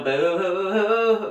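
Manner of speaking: slow spooky show-intro howl; Griffin. Retold or imitated. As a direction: imitate a comedian's eerie hooting. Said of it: "like, his show".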